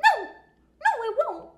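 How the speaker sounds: squeaky high doubting voice; high mock disbelief. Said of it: "she's like".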